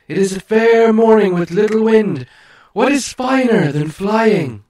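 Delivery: high, loud proud eagle voice. Acted. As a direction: speak as a lofty great eagle, slowing at the end. Eagle speaks to Bilbo.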